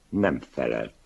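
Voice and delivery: plain reading voice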